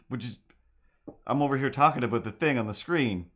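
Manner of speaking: ordinary talk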